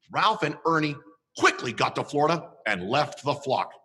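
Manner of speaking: ordinary delivery, nothing marked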